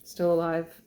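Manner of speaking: ordinary delivery, nothing marked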